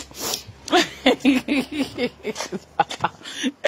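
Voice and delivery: long giggling laugh. Real, not acted. Vlogger laughing on and on at her chant. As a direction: keep laughing.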